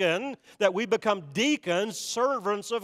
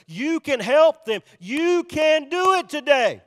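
slow, loud, raised preacher cry; rallying cry; 6 semitones higher, 6 dB louder, slower than usual. Real, not acted. Preacher urging church to help others, rallying cry. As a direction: drive each word home, high and loud.

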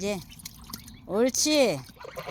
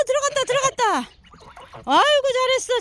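high, fast delighted praise; excited cheer; 10 semitones higher than usual. Real, not acted. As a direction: celebrate the dive. Duck finally dives. (신나서 환호하며)

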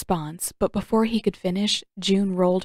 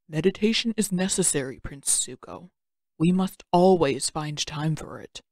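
put-on lower, unhurried wise old uncle voice; calm old uncle; 3 semitones lower than usual. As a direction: speak as a patient old mentor.

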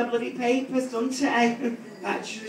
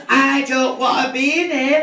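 put-on sulky teenager; 5 semitones higher, 6 dB louder than usual. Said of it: "She says".

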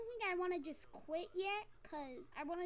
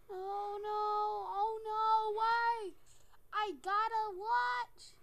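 loud, drawn-out cries of alarm; wailing dismay; 3 semitones higher, 7 dB louder, much slower than usual. Real, not acted. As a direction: wail it slowly, building to a yell. Dismay at a game threat.

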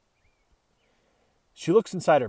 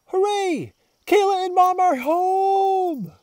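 much higher, louder, slow joyful cry; overjoyed cheer; 13 semitones higher, 5 dB louder, slower than usual. Acted. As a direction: burst with doggy joy. Dog overjoyed at return.

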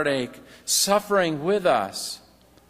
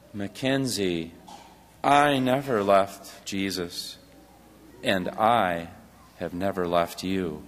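tender reassurance; 8 semitones lower, 3 dB quieter than usual. A narrator's reading. Papa answers Mac tenderly.

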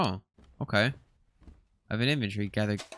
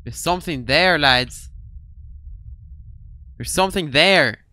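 alarmed; 4 semitones higher, 6 dB louder than usual. Real, not acted. Spotting something in the dark.

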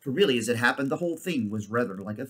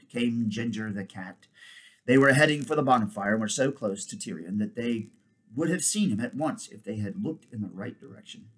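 plain reading voice

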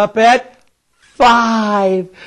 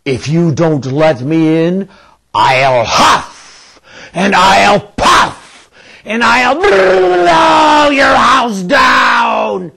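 big bad wolf, slow and swelling; wolf voice, building to a roar; 3 dB louder, slower than usual. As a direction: threaten slowly, end in a roar. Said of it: "said the wolf".

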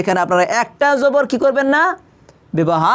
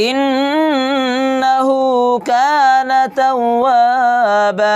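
extremely slow recitation, vowels stretched long; Quran recitation; 4 semitones higher, much slower than usual. Shown as a demonstration. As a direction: draw each syllable out in chant. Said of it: "এখানে ভালো করে খেয়াল করবেন". (অত্যন্ত ধীর তিলাওয়াত)

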